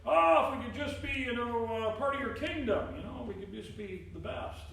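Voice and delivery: high, loud, pleading voice of eager disciples. Retold or imitated. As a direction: beg for a place in the kingdom. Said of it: "And they're like".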